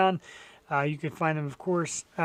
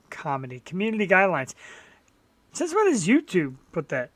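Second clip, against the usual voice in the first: normal talking voice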